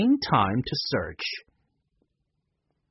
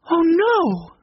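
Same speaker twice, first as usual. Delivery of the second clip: a much higher, louder dismayed wail; 6 dB louder than usual. A narrator's reading. Jessie sees the wrecked library and wails in dismay. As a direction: cry it out at the ruined room. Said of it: "she wailed".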